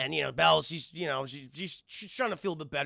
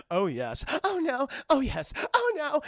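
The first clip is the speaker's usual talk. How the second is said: mock lovemaking; 9 semitones higher, 4 dB louder than usual. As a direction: trade hammed-up passionate moans. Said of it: "Like, literally that hot".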